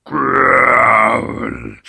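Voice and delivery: deep loud monster growl; loud troll growl. Acted. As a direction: growl like a giant troll cat. Imagined troll cat attacks.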